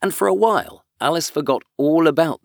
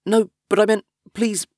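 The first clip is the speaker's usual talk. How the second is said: pleading; 9 semitones higher than usual. Acted. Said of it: "Alice pleaded".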